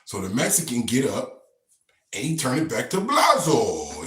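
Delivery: normal talking voice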